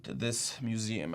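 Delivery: slow, grave accusation; slow right down and let the accusation land; dramatic. Acted. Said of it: "I don't mean to sound so dramatic".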